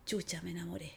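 low, very soft aside; acted quiet, stunned admission; hushed realization. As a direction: drop to a hushed, half-laughing confession. Acts out falling in love realization.

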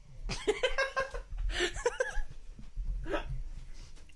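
high breathy giggling; high laughter. Real, not acted. Big laugh after whisper.

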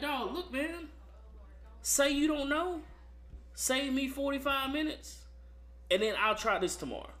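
exasperated plea. Retold or imitated. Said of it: "And I'm like".

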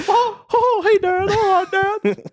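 very high, loud cartoon kid; goofy son voice. Son plays along with Dad, goofy squeaky boy voice. Voicing the sons answering Dad.

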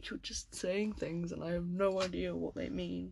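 baffled whisper under breath. Real, not acted. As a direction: whisper it, totally lost. Baffled muttering.

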